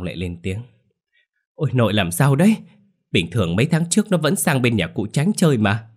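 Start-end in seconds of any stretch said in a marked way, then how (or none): 1.5-5.9 higher, louder worried grandson; high, alarmed questioning; bewildered protest. A narrator's reading. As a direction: protest in a raised, high-pitched voice. Grandson protesting to his raging grandmother.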